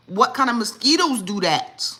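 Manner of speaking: loud incredulous repeat of the question; outraged exclaim. Shown as a demonstration. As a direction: blurt it out in disbelief. Repeats question, now exclaimed loudly.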